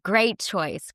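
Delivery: ordinary talk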